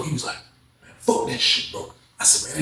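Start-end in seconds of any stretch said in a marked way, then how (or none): 0.8-1.9 retold whisper. Whispered reply at night.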